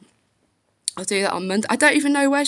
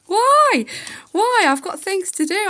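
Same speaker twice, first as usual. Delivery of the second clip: retold outburst at the school gate; indignant yell; 8 semitones higher than usual. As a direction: fire it back at the teacher, outraged. Retelling irate exchange with teacher.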